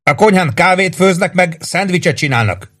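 sustained high-pitched yell; narrated shout, pitch held high; hollering. A narrator's reading. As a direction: holler a string of orders down from above. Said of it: "üvöltötte".

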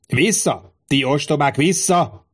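high-pitched, restrained yell; narrated yelling. A narrator's reading. Centurion drives back the crowd, acted yell. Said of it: "keltául üvöltözött".

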